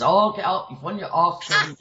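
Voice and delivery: attempted Scottish scolding; Scottish accent. Friend dismissing a faint as acting, mock Scottish accent. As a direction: imitate a Scottish friend's brisk telling-off. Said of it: "I can't do a Scottish accent".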